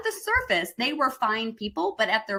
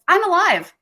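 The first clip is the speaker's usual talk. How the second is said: "I'm alive." louder and much higher, with a falling close; energetic reply; 9 semitones higher, 7 dB louder than usual. Shown as a demonstration. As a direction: declare it with full energy. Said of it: "I go".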